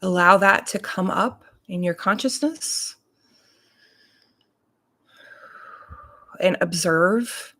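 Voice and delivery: unhurried meditation voice, long pauses between phrases; guided meditation. Real, not acted. Reverend leading morning listeners into breath and body awareness, guided meditation. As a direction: guide them calmly, leaving space to breathe.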